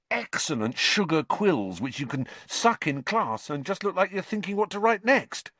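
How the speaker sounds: high-pitched rapt listing; carried away by delight; dreamy relish. A narrator's reading. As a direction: reel off treats with mounting relish. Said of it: "a dreamy look coming over his face".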